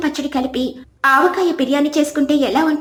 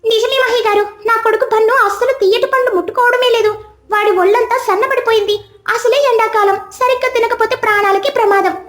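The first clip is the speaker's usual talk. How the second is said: higher, pleading mother's voice; worried mother; 8 semitones higher than usual. Acted. Worried mother pleading with Mahi about her son. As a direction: beg for help for a son who won't eat.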